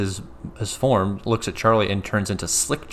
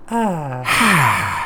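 critter's little sigh; 6 semitones higher, 5 dB louder than usual. Retold or imitated. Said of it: "he just goes".

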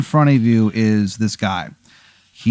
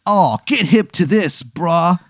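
higher laid-back surfer drawl; surfer dude voice; 6 semitones higher, slower than usual. Acted. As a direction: pitch the deal like a beach bum. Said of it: "he goes".

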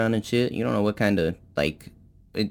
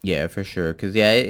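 normal talking voice

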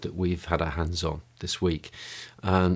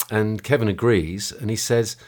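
usual speaking voice

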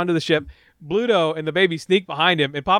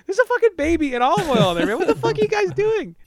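mock outraged yell. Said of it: "It's like".

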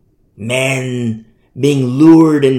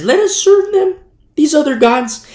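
lightly acted, higher-pitched false preacher; tempting preacher guy; 11 semitones higher, 3 dB louder than usual. Preacher guy urging listeners to other gods, lightly mimicked. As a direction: pitch it up like a preacher luring people.

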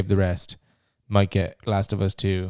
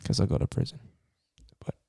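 whispering; 8 dB quieter, faster than usual. Pretend. Threat whispered to a kid.

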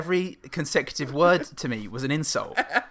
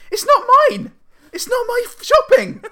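much higher and louder, a near-yelled protest of innocence; 10 dB louder than usual. Retold or imitated. Telling the woman in the Aldi queue it's not his shopping, high loud protest. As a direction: insist it's not yours, voice leaping high and loud, laughing by the end. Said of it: "Like that".

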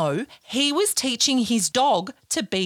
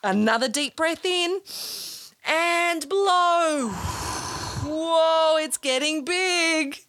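slow, drawn-out breathing cue; guided breathing; 5 semitones higher, slower than usual. Acted. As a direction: stretch out "blow" like coaching a big exhale. Guides children's breathing exercise.

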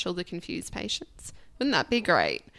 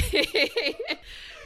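laugh. Real, not acted. Laughing at own story.

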